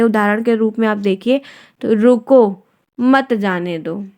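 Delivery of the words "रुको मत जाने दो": रुको on its own, short break, then मत जाने दो drawn out; pause after रुको. Shown as a demonstration. Teacher showing what a comma does, pause after रुको. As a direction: stop briefly right after रुको. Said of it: "वहाँ पे बस थोड़ा सा रुकना है".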